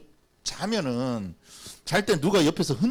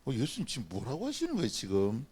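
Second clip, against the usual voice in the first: softer, low, puzzled muttering; doubting disciple; 6 semitones lower, 9 dB quieter than usual. Pretend. Preacher playing a disciple doubting Jesus, puzzled muttering. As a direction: mutter in disbelief, half to yourself. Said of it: "제가 만일 예수님 제자였으면".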